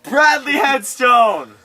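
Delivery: slow, loud, high announcement; dramatic reveal. Real, not acted. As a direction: unveil the villain grandly.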